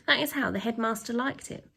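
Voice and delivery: usual speaking voice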